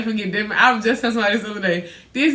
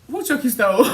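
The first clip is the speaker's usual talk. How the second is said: laughing question; 3 semitones higher than usual. Retold or imitated. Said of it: "I said".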